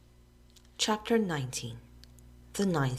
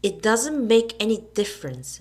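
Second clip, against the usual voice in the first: loud, higher angry yell; 5 semitones higher, 7 dB louder than usual. A narrator's reading. Joe yelling at Billy in the quarrel, loud. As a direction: shout him down. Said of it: "Joe yelled at Billy".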